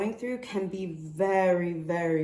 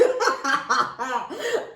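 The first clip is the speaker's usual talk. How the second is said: startled laugh; 7 semitones higher, 6 dB louder than usual. Real, not acted. Startled by a card.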